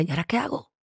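quiet bewildered murmur; hushed despair. Retold or imitated. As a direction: ask it quietly, helpless. Lost, alone after the diagnosis.

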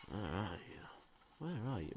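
very soft, under the breath; barely voiced, quiet calling; hushed searching. Real, not acted. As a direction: murmur it to someone hidden. Searching, calling to hidden monster.

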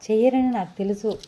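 usual speaking voice

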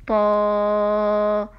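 "طاء" with the long vowel held loud and steady; wydłużone طاء. Shown as a demonstration. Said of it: "حرف ممدود طاء".

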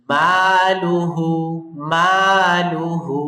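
মালুহু very slowly, মা and হু stretched; long ma and hu. Shown as a demonstration. Teacher drills the word slowly, stretched vowels. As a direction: stretch মা and হু, keep লু short. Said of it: "মাতে টান দিব, আর লু তাড়াতাড়ি".

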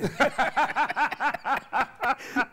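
loud high burst of laughter; real laughing fit; loud, high, delighted laughter; hearty laugh. Real, not acted. Big laugh after joke.

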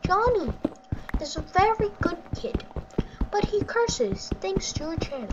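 mock concerned-parent complaint. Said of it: "So fucking parents like".

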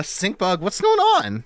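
sudden bewildered squeak; startled. Real, not acted. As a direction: blurt it in shock. Game does something unexpected.

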